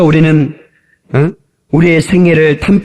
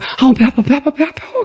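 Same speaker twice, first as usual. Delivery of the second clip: high, repetitive mock whine; feigned stomach ache; 12 semitones higher than usual. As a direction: imitate a jealous girlfriend faking pain. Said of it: "그래서 갑자기 나도 배 아픈 줄". (높은 소리로 꾀병 부리듯)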